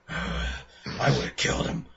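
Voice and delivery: slow, unvoiced whisper of a madman; creepy whisper. Playing a blood-soaked madman for his co-host, slow whisper. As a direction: breathe it out slowly like someone gone dark. Heart of Darkness madman pose.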